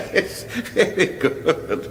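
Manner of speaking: soft breathy chuckle through the words; slow and quiet, laughing along; whispered laugh of amusement; chuckling. Acted. Chuckling at the king's joke.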